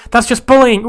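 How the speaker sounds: high, loud mock protest; upset protest. Cook complains about the troublesome rabbit, loud upset protest. Voicing an upset game character.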